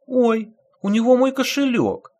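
high startled outcry; exclaiming. A narrator's reading. Woman spotting her wallet in the thief's pocket, startled cry. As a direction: blurt it out in alarm. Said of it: "воскликнула какая-то женщина".

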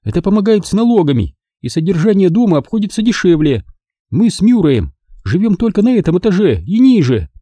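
woman hurrying to justify her lavish house; quickly. A narrator's reading. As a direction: rush out the excuse. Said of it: "быстро сказала она".

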